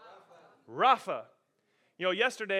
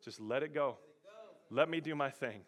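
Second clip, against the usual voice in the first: God's gentle voice; 6 semitones lower than usual. Acted. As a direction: soothe a child gently. God gently speaking to believer.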